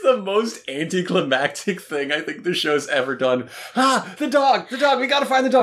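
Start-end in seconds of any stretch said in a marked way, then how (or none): 3.8-5.6 high, hammed-up frantic cry; mock panic. Cohost mocks the characters hunting the dog, high mock panic. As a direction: panic theatrically, pitched way up.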